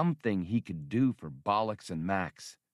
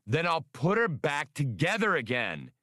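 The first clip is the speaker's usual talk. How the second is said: raised, higher, half-shouted retort; almost yell it, then hold back; near holler; 7 semitones higher than usual. Acted. Han snaps back at Hasty about his ship, near hollering. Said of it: "he near hollered".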